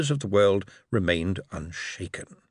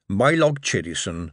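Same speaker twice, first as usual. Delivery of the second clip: plain reading voice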